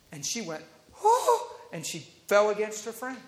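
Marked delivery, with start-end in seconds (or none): 1.0-1.6 high, imitated "oh" of shock; dramatic gasp. Preacher mimicking the girl falling against her friend, dramatic gasp. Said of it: "she's getting pretty dramatic".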